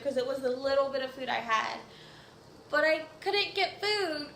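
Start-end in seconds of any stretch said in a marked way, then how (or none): 2.7-4.4 tearful. Real, not acted. Retelling being denied food.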